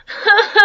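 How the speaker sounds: high giggle mixed with a mock sob; laughing sob. Real, not acted. Teacher laughs between the beaver's cries, a high giggle. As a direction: laugh through the crying voice. Laugh breaking through the cry.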